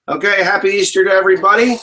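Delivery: hammed-up holiday cheer; playful voice. Hamming it up for viewers with a cheery greeting. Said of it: "I'm entertaining".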